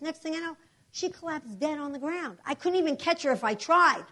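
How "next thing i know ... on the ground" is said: ordinary talk, nothing marked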